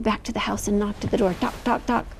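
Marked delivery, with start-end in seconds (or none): none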